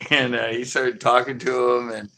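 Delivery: usual speaking voice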